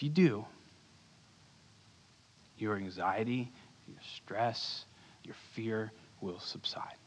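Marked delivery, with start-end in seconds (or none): 2.6-7.0 whisper. Real, not acted. Calming the congregation's fear.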